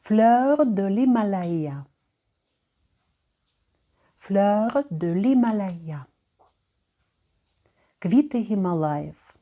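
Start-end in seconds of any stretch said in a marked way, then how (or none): 0.0-6.0 Fleur de l'Himalaya slowly, stress on the last syllable; final-syllable stress. Shown as a demonstration. Teacher showing French final stress slowly. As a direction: lean on the end of l'Himalaya. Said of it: "Наголос завжди падає на останній склад".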